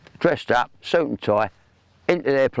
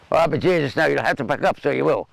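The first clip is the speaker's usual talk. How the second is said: higher-pitched, rough attempt at Irish; badly faked Irish accent; 5 semitones higher than usual. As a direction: have a go at a big Irishman's accent. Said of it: "Sorry about the accent, I can't do it".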